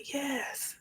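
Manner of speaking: a small, breathy whispered yes. Retold or imitated. Answering her therapist in the retold scene, whispered. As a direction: admit it softly, almost inaudibly. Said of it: "And I said".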